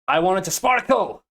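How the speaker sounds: high, loud excited exclamation; excited demand. Real, not acted. Developer wishing aloud for shiny water, excited demand. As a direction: call it out eagerly, high and loud. Excitedly voicing a wish for the shader.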